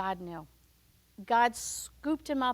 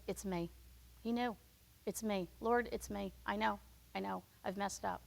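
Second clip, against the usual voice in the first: softer, owning up; quiet confession; 2 semitones lower, 7 dB quieter than usual. Acted. Jonah admitting to the sailors it's him, quiet. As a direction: hang your head and admit it.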